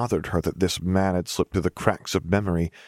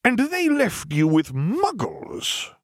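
slow, high, deeply affronted disbelief; 13 semitones higher, much slower than usual. A narrator's reading. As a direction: drag it out, scandalised. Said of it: "in a possibly more affronted tone".

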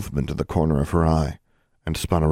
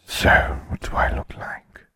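grim reaper voice. A narrator's reading. Death speaks, whispered.

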